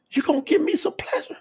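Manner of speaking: high, very soft, quick question; coy Sarah. Acted. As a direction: ask it in a tiny, high voice.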